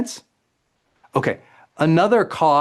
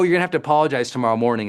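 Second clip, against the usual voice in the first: ordinary delivery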